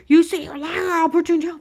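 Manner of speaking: mock agent threat. Agent threatening in retold quarrel.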